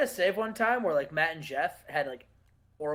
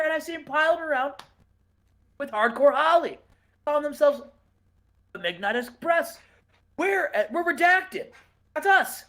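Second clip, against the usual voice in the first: high, loud goofy wrestler voice; Alfred Snow; 9 semitones higher, 5 dB louder than usual. Acted. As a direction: play the clueless wrestler looking for his partner. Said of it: "he comes down and he says".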